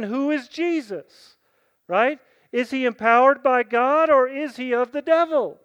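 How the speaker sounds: loud, rising preacher's challenge; fiery pulpit preaching; preaching at full force. Acted. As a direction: hammer the question home to the congregation.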